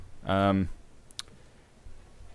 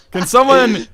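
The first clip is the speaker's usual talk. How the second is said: high cackling laugh; laughing hard; 14 semitones higher, 5 dB louder, slower than usual. Real, not acted. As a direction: crack up, then speak through it. Cracking up at joke.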